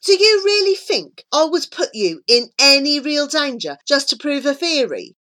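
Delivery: high, emphatic rhetorical question; exasperated. A narrator's reading. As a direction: challenge with mounting impatience. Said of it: "sounding increasingly exasperated".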